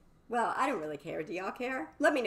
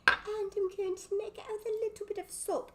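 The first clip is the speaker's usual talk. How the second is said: high put-on voice; 12 semitones higher than usual. Same sentence in high voice.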